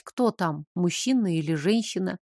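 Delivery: plain reading voice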